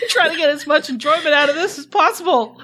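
high, fast, giggly; laughing. Real, not acted. Author excusing her reading, high giggly rush. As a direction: rattle it off still giggling.

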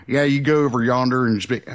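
put-on Southern accent round a wad of tobacco; mock Deep South accent. Giving directions with tobacco in mouth, mock Southern accent. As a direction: imitate a tobacco-chewing Deep South local. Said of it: "people in the Deep South with the big wads of chewing tobacco".

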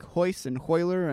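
Hoyce and Hoyler with Brazilian H sounds. Shown as a demonstration. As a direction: say Hoyce and Hoyler with a breathed H for each R. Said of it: "pronounce it like an H".

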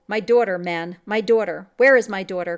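loud, furious demand; raging cry. A narrator's reading. Hunter bursts in raging for his daughter. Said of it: "cried he in a rage".

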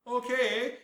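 high, loud cartoon reply; Wolverine. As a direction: call it out as a hammed-up comic-book hero. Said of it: "and Wolverine." He's like".

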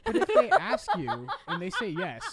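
words squeezed out through laughter; laughing. Real, not acted. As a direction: laugh while you say it. Laughing mid-sentence at joke.

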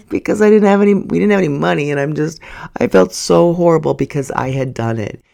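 real tearful laugh-cry, high and loud; breaking into tears mid-sentence, voice climbing high. Real, not acted. Telling viewers about the scam, starting to cry in a real, high, loud voice. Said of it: "and then I started crying".

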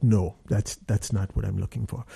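low, restrained director turning someone down; booming director voice. Retold or imitated. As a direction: imitate a deep-voiced director rejecting a reading. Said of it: "it's like James Earl Jonesy".

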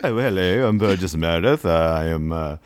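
hammed-up British gent introducing himself; British accent, rising at the end; mock British accent. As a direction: put on a fake British accent. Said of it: "Yeah, he's British".

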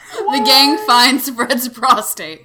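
slow, loud, cracking up; wheezing it out through laughs; laughing. Real, not acted. Announcing title card while laughing.

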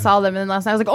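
ordinary talk

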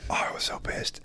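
breathy hushed aside; whispering how angry he was. Real, not acted. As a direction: drop to a sudden whisper. Recalling being angry, dropped voice.

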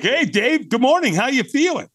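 very high, chirpy morning greeting; John. Retold or imitated. Sponsor phoning a newcomer at eight, playful and chirpy. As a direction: imitate a playful, cheery caller. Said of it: "he's a real playful guy".

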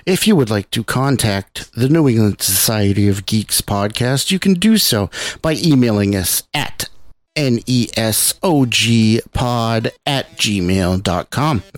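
loud, slow announcer read; deliberate promotional voiceover; podcast announcer. Acted. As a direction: project it like a show outro. Recorded podcast contact announcement.

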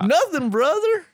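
high, lightly mocking denial; mock innocent twin. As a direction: play innocent, half-hearted. Twin deputy denies insult.

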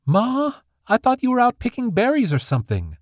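squeak. A narrator's reading. Startled daughter caught by her mother, squeaking high. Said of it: "squeaked Dandy".

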